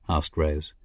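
ordinary delivery, nothing marked

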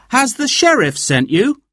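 high, suspicious question; wary young man voice. A narrator's reading. Will voiced by narrator.

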